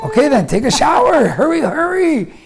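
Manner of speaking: high, urgent exasperation; worked-up, pleading impatience; impatient hurrying. Retold or imitated. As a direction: give in, then frantically rush them along. Hurrying wife as labour starts.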